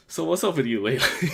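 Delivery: blurted out high and loud, flustered. Retold or imitated. Fan stuck for words at a meet-and-greet, flustered. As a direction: imitate yourself flailing for something to say. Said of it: "I got so, like, flustered".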